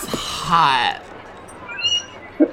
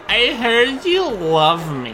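put-on 1 slow dreamy girl; 8 semitones higher, much slower than usual. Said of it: "he's like".

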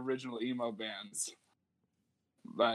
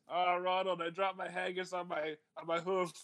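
higher, badly faked Scottish lament; bad Scottish accent; 4 semitones higher, 3 dB quieter than usual. Host admits he can't do the accent, bad Scottish. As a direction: mourn a dropped haggis in a bad Scottish accent. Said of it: "I don't know how to do a Scottish accent".

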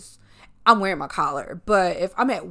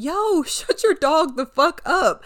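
annoyed neighbors; 8 semitones higher than usual. Retold or imitated. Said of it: "And they were like".

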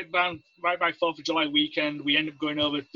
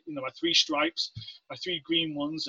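ordinary talk

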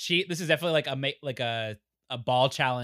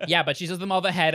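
ordinary delivery

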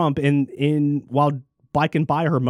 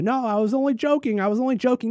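mock excuse; 5 semitones higher, faster than usual. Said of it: "he later came on to say that".

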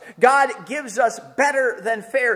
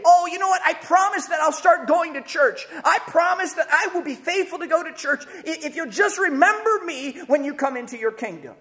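higher eager bargainer; mock pleading bargain; 7 semitones higher than usual. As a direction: promise anything to be remembered. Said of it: "He can't say".

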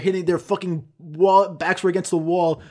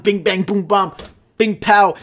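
loud, higher-pitched impact sounds; punchy rally noises; 5 semitones higher, 7 dB louder than usual. Retold or imitated. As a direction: punch out each word like a ball hit. Imitating a frantic rally.

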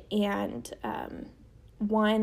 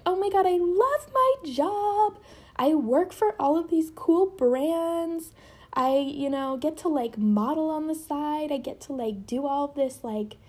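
high-pitched voice; 6 semitones higher than usual. Retold or imitated. Bragging to her old teacher about a job she secretly dislikes, high-pitched. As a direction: pitch jumps way up for a fake-happy brag. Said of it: "Sorry, my voice is going so high".

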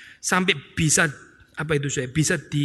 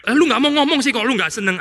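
high, loud, irritated jab at the spouse; exasperated complaint; 6 semitones higher, 3 dB louder than usual. Retold or imitated. Spouse scolding a silent partner, exasperated loud retort. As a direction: snap at them for not speaking up. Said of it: "dia ngomong".

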